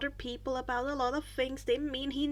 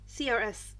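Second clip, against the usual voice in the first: plain reading voice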